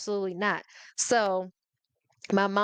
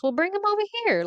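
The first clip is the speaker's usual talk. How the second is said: much higher, fast, sassy; bossy demand; 12 semitones higher, faster than usual. Retold or imitated. A child to the Sunday school teacher, high, fast and sassy. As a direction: snap it out like a bossy kid.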